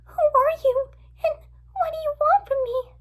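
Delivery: put-on very high, frightened girl voice; high-pitched, tearful alarm; scared girl. A narrator's reading.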